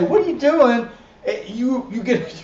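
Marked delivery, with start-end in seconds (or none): 0.0-0.9 mock startled what are you doing, louder; a hint of a shocked friend; incredulous challenge. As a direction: throw the question at them in disbelief. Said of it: "then you have somebody say".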